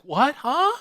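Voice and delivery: squeaky high, loud startled "what, huh"; caught off guard. Retold or imitated. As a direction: play dumb, jumping way up in pitch. Caught with her drink.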